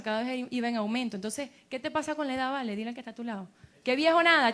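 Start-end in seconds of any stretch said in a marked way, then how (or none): 3.8-4.5 loud, higher-pitched brush-off; emphatic dismissal. Acted. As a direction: firmly wave away the idea, projecting to the room. Anima a rechazar la vejez. (rechazo enfático, en voz alta)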